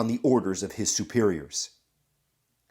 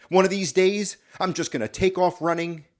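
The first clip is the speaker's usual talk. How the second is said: higher, fed-up soldier's threat; mildly acted defiance, pitched up; defiant threat; 7 semitones higher than usual. Private threatening his captain with desertion, mildly defiant.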